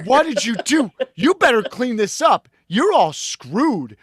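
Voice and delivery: high, loud scolding; stern father. Retold or imitated. Father warning the kids about mom, loud high scolding. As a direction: scold them loudly, no mercy. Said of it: "my father was very clear".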